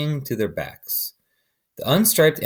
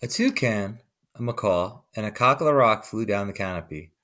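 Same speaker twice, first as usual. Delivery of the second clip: ordinary delivery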